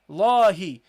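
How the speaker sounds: "Lahi" with a long, loud "La"; slow, heavy, stretched "Lahi"; prolonged fatha. Shown as a demonstration. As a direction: hold the first vowel of "Lahi". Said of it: "We prolong this fatha".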